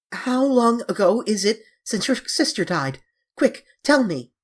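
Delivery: high, loud urgent demand. A narrator's reading. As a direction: press for the answer desperately. Dorian urgently demands answer.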